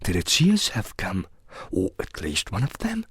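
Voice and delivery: soft, private afterthought; thinking to oneself. A narrator's reading. Alice waiting for the cat's ears, private thought. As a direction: finish the thought under your voice. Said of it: "she thought".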